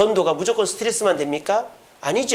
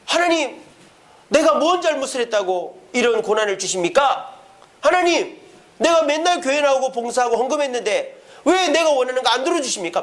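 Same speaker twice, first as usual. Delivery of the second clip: mock angry complaint; 7 semitones higher than usual.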